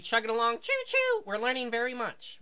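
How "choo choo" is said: high-pitched choo-choo toot; train whistle. Pretend.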